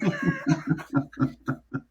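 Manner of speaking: a squeaky high-pitched laugh. Real, not acted. Laughs after joking.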